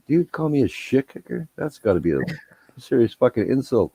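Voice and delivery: high, mock-outraged retort; mock offended. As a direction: act insulted with a sarcastic edge. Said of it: "I'm like".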